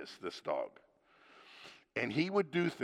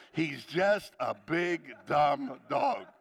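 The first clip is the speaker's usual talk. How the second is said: slow drawn-out quote; much slower than usual. Retold or imitated. Husband quoting wife, slowly.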